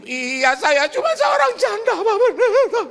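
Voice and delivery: put-on very high, loud crying old lady; mock sobbing widow. Poor widow sobbing to the pastor, very high and loud. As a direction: wail it like a weeping widow. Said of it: "jangan nangis-nangis begini".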